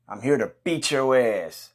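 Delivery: normal talking voice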